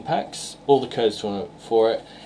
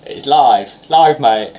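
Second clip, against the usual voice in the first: loud, higher mock cockney; 4 semitones higher, 10 dB louder, slower than usual. Teasing co-host about sounding cockney, in loud mock cockney. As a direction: imitate a cockney, punched out loud. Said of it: "You sounded so cockney then".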